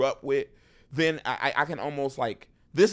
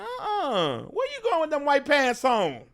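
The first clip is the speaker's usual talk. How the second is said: high, teasing heckle of a fan; crowd-work roast; 13 semitones higher than usual. Pretend. Comic teasing a fan at his show, high sassy roast. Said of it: "I'd be like".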